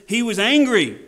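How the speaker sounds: loud, high, forceful ANGRY; acted anger. Shown as a demonstration. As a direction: hit it hard, demonstrating anger. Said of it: "And I acted angry".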